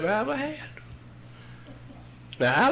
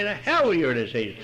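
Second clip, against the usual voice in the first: ordinary talk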